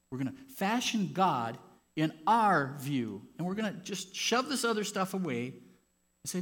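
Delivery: loud, high, driving sermon voice; rising to a loud pulpit shout; full-force preaching. Acted. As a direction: preach it out to the back row.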